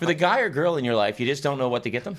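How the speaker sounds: booming eighties TV ad pitch; announcer voice. Acted. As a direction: sell it like a K-Tel commercial. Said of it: "channeling, like, a nineteen eighties K-Tel commercial".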